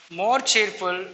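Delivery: usual speaking voice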